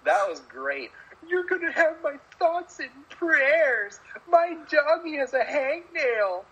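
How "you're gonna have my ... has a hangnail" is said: high, slow, mocking Heyman voice; mock a smarmy wrestling manager; Paul Heyman impression. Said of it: "the awesome Paul Heyman promo".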